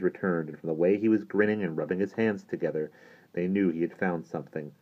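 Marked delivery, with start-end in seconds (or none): none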